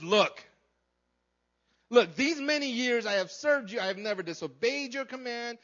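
loud, emphatic acted grievance; angry older brother. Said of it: "But he was angry".

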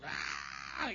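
put-on toddler scream. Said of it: "He's like".

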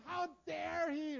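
high, loud, drawn-out outrage; hammed-up wronged protest; mock indignation. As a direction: act deeply offended, stretching the words. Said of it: "All of this stuff, like".

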